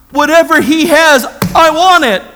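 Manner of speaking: loud, high and insistent; imitated emphatic declaration. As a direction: build her words to a preacher's climax. Voices wife's climactic declaration.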